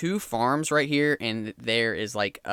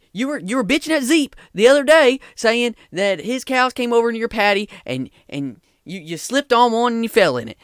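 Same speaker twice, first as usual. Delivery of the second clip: higher, louder, fed-up scolding; scrawny peacemaker yelling at two old farmers; exasperated farmhand; 4 semitones higher, 4 dB louder than usual. Acted. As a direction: break up the feud, tired and angry. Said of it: "hear the smaller guy speak, and he's like".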